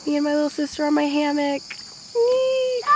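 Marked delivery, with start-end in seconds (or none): 2.1-2.8 high delighted squeal on the swing; gleeful whee. Real, not acted. On the hammock with her little sister, squealing with glee. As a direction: let out a joyful squeal.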